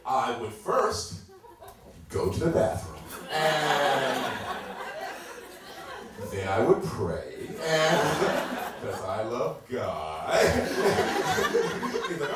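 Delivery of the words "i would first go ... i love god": slow, drawn-out answer with long pauses; stalling for time. Acted. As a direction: stretch each phrase to buy time.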